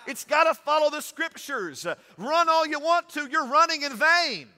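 loud, high preaching with shouted peaks; full-force preaching. Acted. Pastor driving the race rules home to church, shouting preaching. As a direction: preach it hard, building to the shout.

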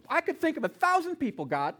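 high, loud complaint, dropping on the final word; loud protest. Retold or imitated. Husband complaining to God about his wife's illness, loud protest. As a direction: re-enact an exasperated plea to God. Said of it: "I asked God loudly, repeatedly".

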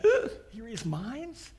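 slow, startled mock groan; hammed-up surprise. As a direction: caught out and rattled. Teachers caught out by Jesus.

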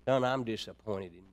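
soft, low, hurt mother's voice; tearful disappointment. Retold or imitated. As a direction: say it like a mother holding back tears. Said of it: "starts shedding them tears".

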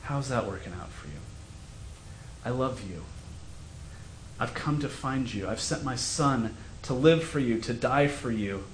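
tender, quiet invitation; gentle God. Acted. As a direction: speak it gently like God calling you home. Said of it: "says to us gently".

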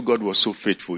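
normal talking voice

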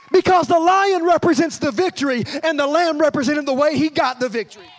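loud, high proclamation; impassioned preacher voice; fervent preaching. Real, not acted. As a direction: declare it forcefully to the congregation. Preaches at full force.

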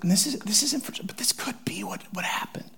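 breathy whispered aside. Real, not acted. Preacher confiding to the congregation in a whisper. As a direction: drop to a whisper as if sharing a secret. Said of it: "whispered aside".

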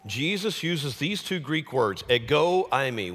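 ordinary delivery

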